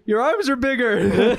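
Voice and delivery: high, slow, laughing imitation; mock gushing. Classmate admires friend's arms at gym, mock gushing. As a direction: marvel like a doting relative, hammed up.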